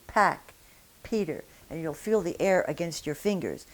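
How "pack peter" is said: Pack and Peter with a big puff of air; aspirated English p. Shown as a demonstration. Teacher demonstrating English p, strong puff. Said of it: "puff of air coming through".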